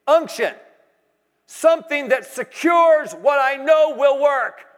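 full-force preaching; preaching shout. Acted. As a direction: drive the point home.

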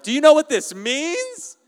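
high, loud, drawn-out panicked yell; hammed-up hysteria, voice shooting way up; mock freak-out. Library crowd panicking over a stolen phone, mock freak-out. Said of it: "everyone else in the library is freaking out".

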